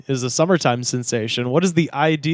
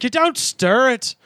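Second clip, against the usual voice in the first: mock angry waitress; 7 semitones higher, slower than usual. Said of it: "she's like".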